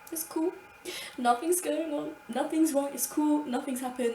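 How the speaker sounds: high, light, too-calm voice; high fake-breezy denial while lying on the ground; mock-casual denial. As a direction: pretend everything's fine, forced cheer. Pretending nothing happened, lying there.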